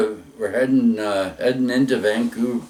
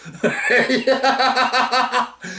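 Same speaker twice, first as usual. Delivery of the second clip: long high cackle of laughter; real drawn-out high laugh; 13 semitones higher than usual. Real, not acted. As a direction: laugh hard at your own story. Laughs at his own story.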